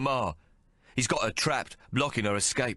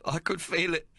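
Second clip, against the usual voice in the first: sobbing; 9 semitones higher than usual. Acted. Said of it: "he sobs".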